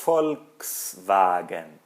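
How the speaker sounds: Volkswagen very slowly, V said as F. Shown as a demonstration. Teacher showing students the V said as F. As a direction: stretch out Volkswagen with an F start. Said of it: "como se fosse um F".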